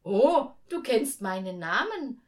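loud, startled "Oh", then easing; surprised angel. A narrator's reading. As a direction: react with pleased astonishment. Angel is surprised at his name.

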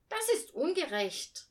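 high, loud indignant cry; indignant outcry. A narrator's reading. Girl protests to father about the sled, high and loud. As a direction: protest it as deeply unfair. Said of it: "rief Hannah empört".

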